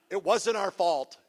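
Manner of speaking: slow, laughing excuse; mock denial. Shown as a demonstration. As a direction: play the guilty one denying it.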